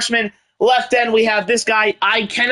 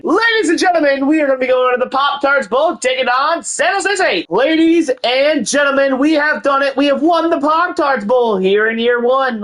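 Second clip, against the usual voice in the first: higher, grand ladies-and-gentlemen announcement; mock announcer; 5 semitones higher than usual. Gamer to viewers, announcing the Heisman winner and the bowl win, mock-grand announcer. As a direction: present it like an awards-show host.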